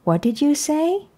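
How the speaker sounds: high, rising "WHAT DID YOU SAY"; questioning mom. A narrator's reading. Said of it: "asked Mom".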